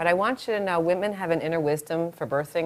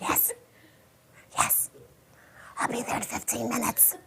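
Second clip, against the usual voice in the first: voiceless, spent gasping words; weak whispered reply; strained whisper; 3 semitones higher, 10 dB quieter than usual. Acted. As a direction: play barely able to talk through a contraction. Acts caller spent after contraction.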